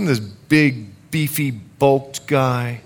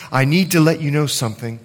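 ordinary talk

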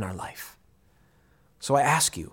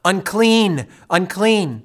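crying out; 5 semitones higher, 5 dB louder, much slower than usual. Retold or imitated. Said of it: "cry out".

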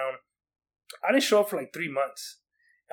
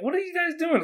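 much higher-pitched, incredulous question; baffled reaction; 14 semitones higher than usual. Retold or imitated. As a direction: ask it like you can't believe the fuss. Baffled at classmates surrounding the teacher.

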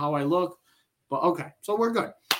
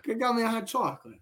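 high put-on voice, needling him; mock teasing voice; 7 semitones higher, faster than usual. Host teasing cohost about the break, in a high put-on voice. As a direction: tease him in a silly high voice.

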